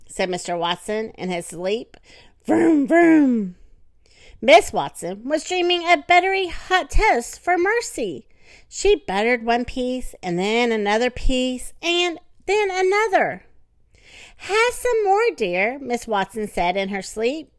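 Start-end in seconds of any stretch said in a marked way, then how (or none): 2.4-3.6 loud, high engine noise; car engine vroom. Acted. Husband dreaming of fast car, loud engine noise. 14.4-15.8 sweet offering voice. Acted. Wife offers toast in sleep.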